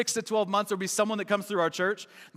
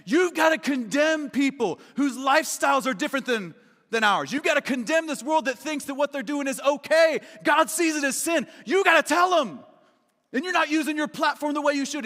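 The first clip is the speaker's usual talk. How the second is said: hammed-up shouting of a churchgoer's demands; mock angry shout; 6 semitones higher than usual. An angry visitor chewing out the pastor in the lobby, mock shouting.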